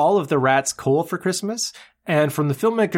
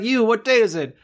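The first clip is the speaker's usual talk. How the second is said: higher-pitched call from a window; mock calling out; 7 semitones higher than usual. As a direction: hail a passer-by below.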